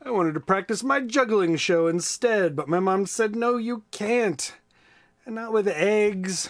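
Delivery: lively, high storybook voice; reading to children. A narrator's reading. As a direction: read it big and bright for kids. Reads a children's book aloud.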